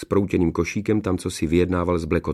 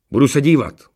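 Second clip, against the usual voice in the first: high, loud threat; forceful follow-up warning; emphatic warning; 6 semitones higher, 7 dB louder than usual. A narrator's reading. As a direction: let him know he is watched. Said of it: "Varoval ho důrazně".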